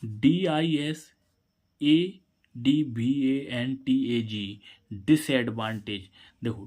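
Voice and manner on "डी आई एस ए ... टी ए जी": letters spelled out very slowly; slow dictation for students; slow spelling. Shown as a demonstration. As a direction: spell डी आई एस ए डी वी ए एन टी ए जी drawn out. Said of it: "इस meaning में देखो".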